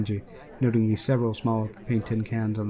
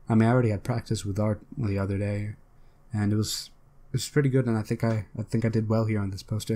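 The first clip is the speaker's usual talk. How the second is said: ordinary delivery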